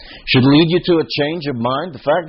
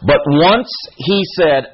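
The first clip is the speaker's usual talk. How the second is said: ordinary delivery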